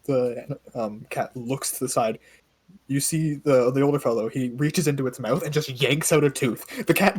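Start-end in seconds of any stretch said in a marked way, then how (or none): none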